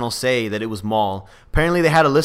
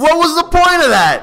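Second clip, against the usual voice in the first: exasperated; 14 semitones higher, 5 dB louder than usual. Real, not acted. Ranting about Maul's lightsaber scene.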